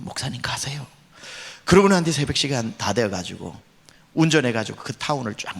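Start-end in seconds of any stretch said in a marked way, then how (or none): 0.0-0.9 soft unvoiced whisper. The calmed man whispers to the pastor to leave. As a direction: breathe it out, barely audible.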